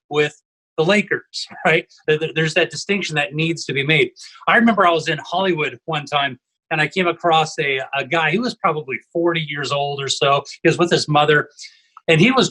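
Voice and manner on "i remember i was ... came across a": normal talking voice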